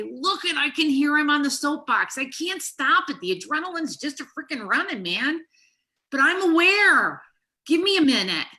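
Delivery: loud, high, worked-up rant; retold angry outburst. Wife to husband mid-argument, loud and fired up. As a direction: let the adrenaline run the words. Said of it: "I was so freaking angry".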